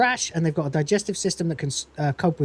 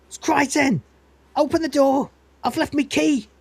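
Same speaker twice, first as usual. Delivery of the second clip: calling out; 9 semitones higher than usual. Retold or imitated. Locked out, shouting for the door to be opened.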